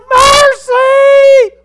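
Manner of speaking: very high full-force scream, drawn out; desperate cry. Acted. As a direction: scream it as if from torment. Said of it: "He cried".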